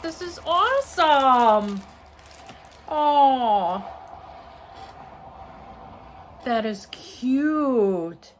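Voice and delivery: loud, high, drawn-out excitement; gushing delight. Real, not acted. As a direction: gush slowly over a lovely surprise. Gushing over gifts in the package.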